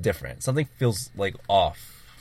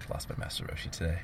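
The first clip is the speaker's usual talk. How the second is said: mock tragic; 3 semitones lower, 11 dB quieter than usual. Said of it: "So dramatic".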